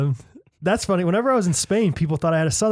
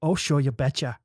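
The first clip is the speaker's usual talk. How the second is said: mock Minnesota accent; 2 semitones lower than usual. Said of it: "let me try again".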